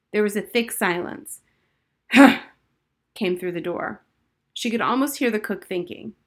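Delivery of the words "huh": loud skeptical huh. A narrator's reading. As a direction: grunt doubtfully, loud. Cook grunts.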